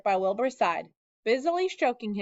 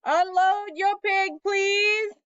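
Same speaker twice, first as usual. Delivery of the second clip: higher, slow, grand fair announcer; pompous loudspeaker; 10 semitones higher, 4 dB louder, much slower than usual. A narrator's reading. Loudspeaker announcing the pig to the fair crowd, pompous and slow. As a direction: announce it like the fair's proud loudspeaker. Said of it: "said the loudspeaker".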